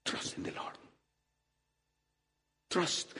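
ordinary delivery

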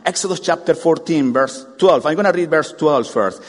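ordinary talk

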